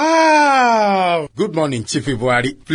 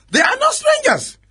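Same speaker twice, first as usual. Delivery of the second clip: forceful rebuttal. Acted. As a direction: shut down the chief's claim. DPO rebuts chief forcefully.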